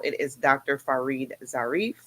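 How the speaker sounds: normal talking voice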